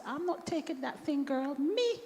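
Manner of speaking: high, bright Caribbean accent imitating mother; elderly Caribbean mother's voice, Caribbean accent. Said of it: "so I'm gonna bring my Caribbean accent now".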